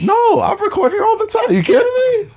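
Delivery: high laughing disbelief; laughing protest. Real, not acted. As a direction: squeal it out through laughter.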